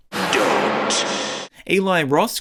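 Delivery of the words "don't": much lower, slow ominous trailer title; dramatic trailer voice. Pretend. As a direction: drop into a deep horror-trailer boom.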